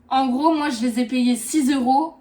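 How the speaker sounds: thrilled about a bargain; excited bragging. Real, not acted. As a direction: announce the steal proudly.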